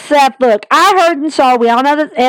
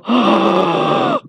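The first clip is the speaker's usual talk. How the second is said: a high, quick intake of breath, softer than the talk; imitated crowd gasp; 4 dB quieter than usual. Vlogger imitating the crowd hearing Dean's name, high soft gasp. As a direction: gasp like fans hearing a name they shouldn't. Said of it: "you literally hear the crowd go".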